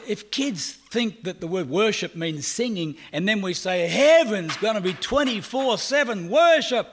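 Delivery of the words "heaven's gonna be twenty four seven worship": higher, louder, hammed-up cheery announcement; mock announcement. Preacher imitating adults telling kids about heaven, bright and loud. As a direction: sell it like an eager church leader.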